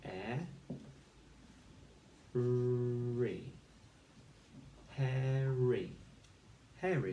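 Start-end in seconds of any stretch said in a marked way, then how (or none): 0.0-3.5 h said alone, then airy, with a long pause between; segmented phonics sounds; sounding out phonics. Shown as a demonstration. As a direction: split hairy into h and airy. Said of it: "So we say".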